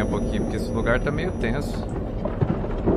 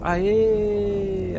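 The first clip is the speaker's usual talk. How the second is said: excited cheer; 11 semitones higher than usual. Real, not acted. Cheers after solving puzzle.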